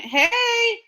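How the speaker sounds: high, loud "Hey!" burst; loud exclamation. Retold or imitated. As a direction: shout it out suddenly. Sudden outburst mid story.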